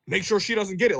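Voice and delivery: hammed-up petty guest keeping money from her; mock spiteful customer. Customer spitefully withholding tip.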